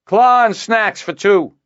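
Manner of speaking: loud, high call down the shaft; shouting. A narrator's reading. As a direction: shout it down a long shaft, slowly. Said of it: "and shouted".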